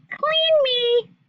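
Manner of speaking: high, loud squeal from the pants; pants screaming. Acted. Pants screaming at their owner, high and loud. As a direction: cry out like dirty pants begging. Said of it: "my pants screamed".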